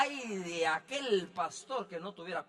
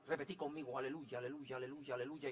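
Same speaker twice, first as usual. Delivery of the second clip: a hint of a chanting minister, soft; mock coaching the aleluya; mimicking a minister; 6 semitones lower, 11 dB quieter, faster than usual. Pastor mimics old ministers coaching new believers. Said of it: "empezaban a decirle".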